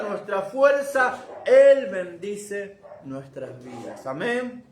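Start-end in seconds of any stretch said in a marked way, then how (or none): none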